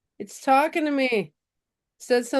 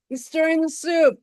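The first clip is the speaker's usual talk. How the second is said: ordinary talk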